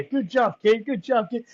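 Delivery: put-on American accent. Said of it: "giving Lewis an American accent".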